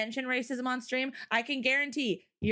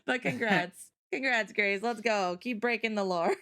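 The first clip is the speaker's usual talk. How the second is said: normal talking voice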